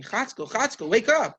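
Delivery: retold urgent call to a sleeper; calling to wake someone. Wife rousing sleeping husband.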